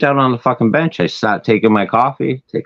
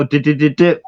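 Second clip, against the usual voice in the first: exaggerated stammer, pitched up; mocked stutter; 4 semitones higher than usual. Speaker retorting to the cop, mock stutter. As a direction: throw the imitated stutter back. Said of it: "'cause I stutter sometimes".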